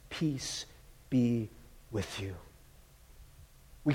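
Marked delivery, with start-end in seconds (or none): 0.1-2.4 very soft and low, with pauses between words; hushed, low, spaced-out blessing; gentle greeting. Retold or imitated. Jesus greets the disciples who betrayed him, hushed and gentle.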